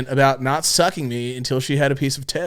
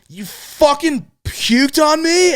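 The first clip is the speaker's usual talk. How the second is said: much higher, slow, stunned outrage; outraged disbelief; 11 semitones higher, much slower than usual. Retold or imitated. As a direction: stretch it out in shocked disgust. Retold outraged reaction.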